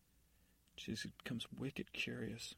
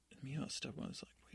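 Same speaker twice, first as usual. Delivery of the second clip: usual speaking voice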